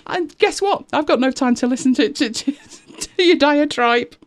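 high-pitched comic indignation, breaking into a laugh; mock-exasperated retort. Creator hitting back at time-wasting complainers, mock exasperated. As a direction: throw it back at the whinger, high and stumbling.

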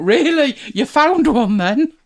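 high, loud, slow incredulous "really?"; sarcastic disbelief. Retold or imitated. Disbelief at Apple's promise.

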